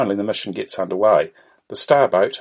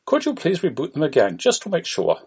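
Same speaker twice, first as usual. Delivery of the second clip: put-on higher-pitched Indian accent; Indian-accented call centre greeting; 7 semitones higher than usual. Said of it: "An Indian voice is heard".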